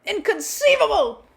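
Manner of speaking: high, slow, loud Sicilian-style cry of Inconceivable; hammed-up movie accent, stretched out; bad Princess Bride accent. Quoting The Princess Bride villain in a bad accent, loud and high. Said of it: "That was probably the worst accent".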